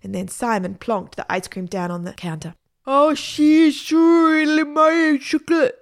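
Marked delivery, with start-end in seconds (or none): 2.9-5.8 slow, loud, high garbled wail; thick-tongued mangled words, drawn out; swollen-tongue speech. A narrator's reading. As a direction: talk round a huge swollen tongue. Woman with huge swollen tongue.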